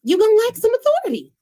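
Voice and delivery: high, loud and quick, hammering the point home; emphatic punchline. Real, not acted. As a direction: belt out the warning to the audience.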